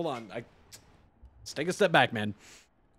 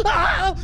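sudden high, loud scream of fright; real scream; 12 semitones higher, 6 dB louder than usual. Real, not acted. Player jumping in a scary game, real scream. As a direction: let out a startled, high-pitched shriek. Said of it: "I, like, had to scream".

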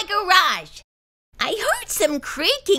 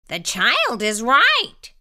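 low slow monster teacher; 4 semitones lower, slower than usual. A narrator's reading. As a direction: rule firmly like a teacher. Mister Z voiced.

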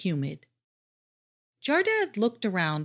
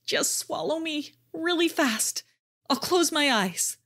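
high, small squeak of a voice; a tiny terrified squeak; 9 semitones higher than usual. A narrator's reading. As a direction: squeak it out in fright. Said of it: "he managed to squeak out".

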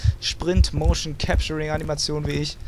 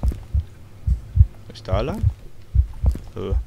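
slow, breathy hushed question; tense sneaking whisper; 8 semitones lower, much slower than usual. Acted. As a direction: whisper so nothing hears you. Sneaking in dark corridor.

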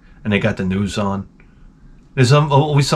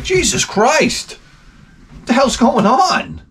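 much higher, slow shocked exclamation; mock horror at the news; shocked outburst; 11 semitones higher, slower than usual. Narrator reacting to the crossing guard, high shocked outcry. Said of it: "I was like".

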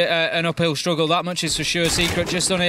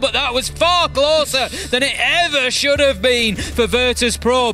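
raised, urgent commentary pitch; excited play-by-play; 6 semitones higher than usual. Real, not acted. Caster calling a round-winning kill to viewers, excited play-by-play. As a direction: call the final kill with rising excitement.

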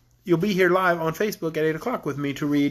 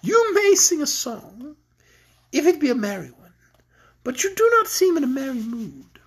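higher-pitched, leisurely teasing queen; amused queen; 7 semitones higher than usual. Acted.

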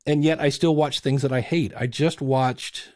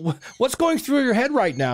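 sports interviewer; 10 semitones higher than usual. Acted. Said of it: "when you ask the, the winning quarterback".

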